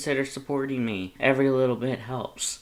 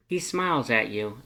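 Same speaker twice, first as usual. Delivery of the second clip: ordinary delivery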